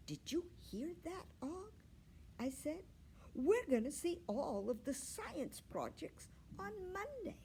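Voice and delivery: high, eager hamster voice; bursting with news for his friend; light excited hamster chatter; excited squeak. A narrator's reading. Excited hamster tells frog friend.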